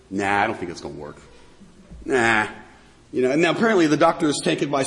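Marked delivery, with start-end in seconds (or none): 0.1-2.5 low, offhand brush-off; dismissive. Acted. Patient turns down the specialist's remedy, dismissive. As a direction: wave it away in a low mumble of doubt.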